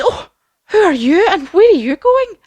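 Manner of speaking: high, loud, singsong character voice; startled little storybook figure; Tin Man waking up. Acted. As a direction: ask it loud and high, full of wonder. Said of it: "the Tin Man wakes up".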